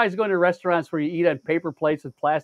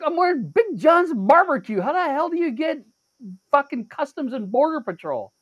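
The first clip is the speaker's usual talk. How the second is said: high-pitched retold disbelief; incredulous protest; 9 semitones higher than usual. Shopper answering a nosy stranger, incredulous. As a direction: protest in baffled exasperation, pitched way up. Retelling his baffled protest.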